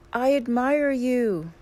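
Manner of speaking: high, loud, drawn-out call; scream to the mountain. A narrator's reading. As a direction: shout it slowly to a far-off mountain. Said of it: "he screams to the mountain".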